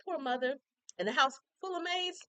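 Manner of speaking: high, incredulous mockery; mocking disbelief. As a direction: scoff at the lame excuse. Mocking the excuse.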